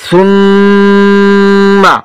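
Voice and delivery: সুম্মা with a long, steady hum on ম; held ghunnah. Shown as a demonstration. Teacher demonstrating ghunnah to students, সুম্মা with prolonged ম. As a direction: hold the ম of সুম্মা very long, then release. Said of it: "কিছুক্ষণ সময় দূরে রাখতে হবে".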